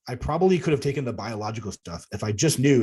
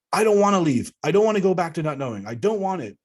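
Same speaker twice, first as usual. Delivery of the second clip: higher, louder defiant protest; 6 semitones higher, 4 dB louder than usual. Retold or imitated. [name] defies the elder who orders him off, loud and defiant. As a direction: dig your heels in and refuse. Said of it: "I changed my intention to defiance".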